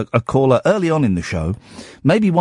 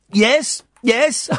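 put-on child's voice; 11 semitones higher than usual. Son admits, voiced by dad.

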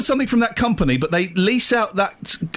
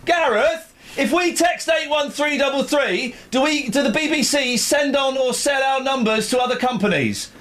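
raised, higher call to a colleague; calling across the room; 6 semitones higher than usual. Retold or imitated. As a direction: shout the question across the office.

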